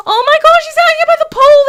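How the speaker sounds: very high, loud alarm; high loud panicked exclamation. Real, not acted. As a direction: cry out in alarm. Alarm at child near pool.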